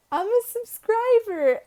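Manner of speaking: put-on high, loud, squeaky kid voice; excited little girl. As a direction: play an excited seven-year-old. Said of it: "she turns back to me and she goes".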